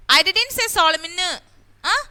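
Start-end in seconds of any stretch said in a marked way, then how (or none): 0.7-1.1 "Solomon" with the last N voiced, sol-em-en; wrongly sounded N. Shown as a demonstration. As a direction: add the N at the end of "Solomon". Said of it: "Did I say that?".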